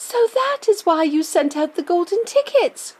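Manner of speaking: put-on high, eager boy's voice; child crying out in discovery; excited cry. A narrator's reading. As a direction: burst out as the penny drops. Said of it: "cried Charlie".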